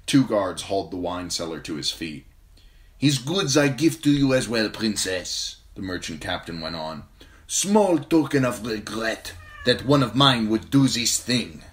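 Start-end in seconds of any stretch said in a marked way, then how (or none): 3.0-11.6 higher, louder stern order; commanding captain. A narrator's reading. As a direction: bark the order at the guards. Captain apologizing to princess.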